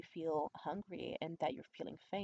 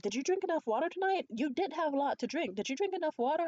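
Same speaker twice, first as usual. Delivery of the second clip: higher, louder imitated fussing; concerned onlookers; 9 semitones higher, 6 dB louder than usual. Date and others fussing over water, high imitated concern. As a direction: mimic anxious helpers in a raised pitch.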